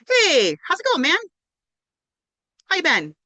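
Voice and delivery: quick, careless greeting with no real attention; casual breezy greeting. Shown as a demonstration. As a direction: toss it off like a generic hallway hello. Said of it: "Rather than".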